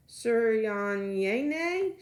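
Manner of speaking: 'Suryanyene' sounded out slowly, high and loud, syllable by syllable; teacher struggling with a name. A narrator's reading. Teacher sounding out a new student's name, slow and unsure. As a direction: stumble through 'Suryanyene' like a teacher trying hard. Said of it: "her eyebrows twitching as she attempts to sound it out".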